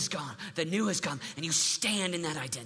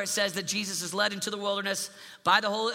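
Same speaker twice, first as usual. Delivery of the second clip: ordinary delivery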